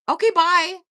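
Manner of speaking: high, loud brush-off; dismissive bye. Real, not acted. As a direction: wave him away with a sharp high bye. Dismissing Milford loudly.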